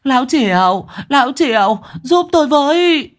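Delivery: wife yelling to husband for help; shouting for help. Acted. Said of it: "gào về phía sân".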